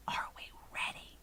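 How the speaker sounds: barely audible whispered question; very soft. A narrator's reading. Sophie repeats her question to the giant, whispering. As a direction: whisper it with great care. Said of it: "She spoke so softly".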